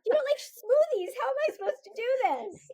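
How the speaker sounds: high, loud comic dismay; hammed-up flustered pleading; mock panic. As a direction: protest as if your whole act just collapsed. Retells panic when shtick fails.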